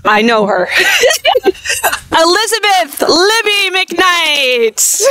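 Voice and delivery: slow, choked calling through real tears; crying through names. Real, not acted. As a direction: call each name while fighting back real tears. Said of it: "And I'm gonna try not to cry".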